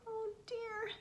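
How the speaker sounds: sigh, then whisper it in dismay; discouraged sigh. Acted. Mouse is discouraged.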